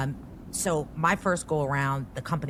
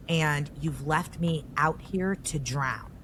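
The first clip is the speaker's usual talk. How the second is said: ordinary talk, nothing marked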